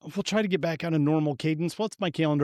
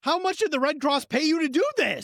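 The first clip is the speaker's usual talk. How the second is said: incredulous outrage, pitch way up; high, indignant challenge; mock indignant; 12 semitones higher than usual. Host challenging a Red Cross fundraiser, high mock outrage.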